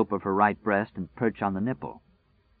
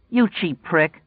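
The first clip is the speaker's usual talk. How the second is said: higher, louder snapped insult; flashing insult; 9 semitones higher, 4 dB louder, slower than usual. Acted. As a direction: spit the insult at him. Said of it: "she flashed at him".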